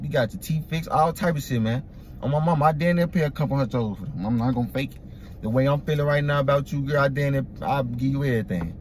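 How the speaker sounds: ordinary talk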